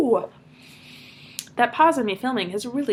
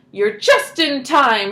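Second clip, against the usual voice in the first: loud, slow call; shouted; 4 semitones higher, 6 dB louder, much slower than usual. A narrator's reading. Grandpa shouts to Charlie, slow and loud. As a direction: call it out slowly, louder. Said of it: "shouted Grandpa Joe".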